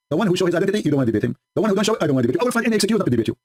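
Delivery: ordinary talk, nothing marked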